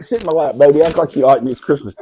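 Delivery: ordinary talk, nothing marked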